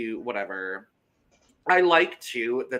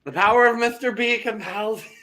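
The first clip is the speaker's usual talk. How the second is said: high, loud exorcism chant; playful ringing incantation; mock exorcist; 10 semitones higher, 6 dB louder than usual. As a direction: proclaim it like a hammed-up exorcist.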